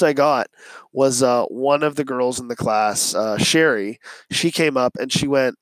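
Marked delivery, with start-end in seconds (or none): none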